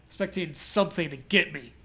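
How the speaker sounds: ordinary delivery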